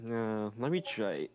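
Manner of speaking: ordinary delivery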